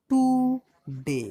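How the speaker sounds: "টু ডে" in two drawn-out beats; split into syllables. Shown as a demonstration. Teacher showing pupils a two-syllable word, "টু ডে" split apart. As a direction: say "টু ... ডে" with a clear gap between syllables. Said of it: "দুবার উচ্চারণ হচ্ছে".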